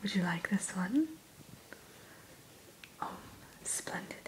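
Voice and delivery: usual speaking voice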